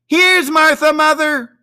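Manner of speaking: put-on two excited kids yelling the news; high loud cheering children's cry. A narrator's reading. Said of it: "cried the two young Cratchits".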